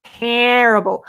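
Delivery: slow, drawn-out Terrible with the stress pulled wrong; teasing impression of a New Hampshire Terrible; mimicking his terrible. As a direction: drag out Terrible, leaning on the wrong part. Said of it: "get him to say terrible".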